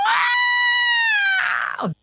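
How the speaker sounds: very high, drawn-out wow; excited wow. Real, not acted. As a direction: squeal it out amazed. Excited about book value.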